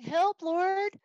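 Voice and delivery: high, loud pleading cry to God; desperate cry. Acted. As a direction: cry out to the Lord, begging. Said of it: "a prophet coming and saying".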